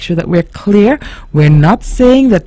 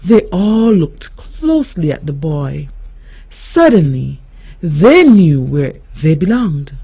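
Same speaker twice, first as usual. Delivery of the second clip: usual speaking voice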